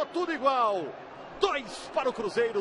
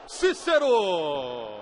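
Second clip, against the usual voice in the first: long stretched goal scream, higher; goal shout; 5 semitones higher, slower than usual. Real, not acted. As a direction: belt out the goal call. São Paulo goal being called.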